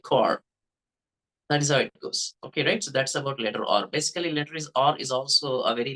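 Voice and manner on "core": American "core", full r at the end; American accent. Shown as a demonstration. Teacher contrasts American "core" with British. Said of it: "for Americans, it would be like".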